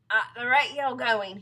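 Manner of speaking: loud, slow pronouncement; grave husband. Acted. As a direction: weigh up her doom aloud. Husband gives dire prognosis.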